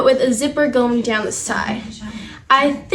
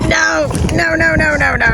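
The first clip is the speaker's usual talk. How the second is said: playful higher voice for the stubborn horses; voicing the horses; 3 semitones higher than usual. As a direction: refuse like a horse, hammed up. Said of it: "They're like".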